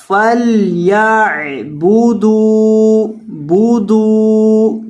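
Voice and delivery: فليعبدوا with the دال stretched long; long d in budu. Shown as a demonstration. Teacher stretching the dal so students hear it. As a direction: hold the dal long on بودو. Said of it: "دال کو لمبا کرنا ہے".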